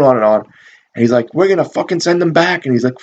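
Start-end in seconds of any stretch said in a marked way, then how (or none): 1.3-2.7 higher-pitched retold rant; mock yelling. Captain threatening his cops, mock yelling. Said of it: "he's, you know, yelling".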